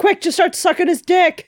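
high loud frantic joke; panicked shout. Pretend. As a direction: yell it in mock panic.

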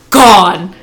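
loud and dramatic; dramatic reveal. Acted. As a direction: land it like a shock reveal. Empty fridge, calzone eaten.

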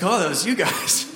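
high-pitched, impressed exclamation; surprised realization. Retold or imitated. Local man recognizing the group from the city, acted surprise. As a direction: act out a sudden delighted recognition.

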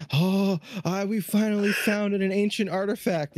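villain at last digs up the ancient artifact, lightly mimicked triumph; lightly voiced villain. Hammed-up finder of a long-sought treasure. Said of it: "you have this villain who had".

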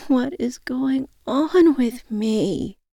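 high, slow, drawn-out wail; tearful. A narrator's reading. Woman slumped over crying asks herself, tearful. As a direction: wail it helplessly as if starting to cry. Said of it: "slumped over and started to cry".

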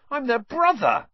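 sulky wounded pride; outraged. A narrator's reading. Ron protesting to his friends, outraged.

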